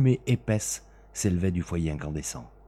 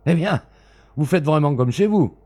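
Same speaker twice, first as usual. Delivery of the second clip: high, loud indignant outburst; astonished anger; 10 semitones higher, 10 dB louder than usual. Acted. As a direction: bristle at the intruder's nerve. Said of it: "l'étonnement et la colère".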